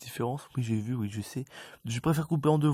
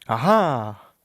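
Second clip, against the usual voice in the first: triumphant ah-ha; 11 dB louder than usual. Real, not acted. Exclamation alone.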